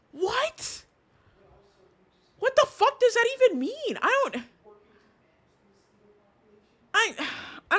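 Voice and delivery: very high, squeaky incredulous outburst; baffled disbelief. Real, not acted. Reacting to a bizarre message just read, high squeaky bafflement. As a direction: shoot the pitch way up in sheer confusion. Baffled by the hot dog line.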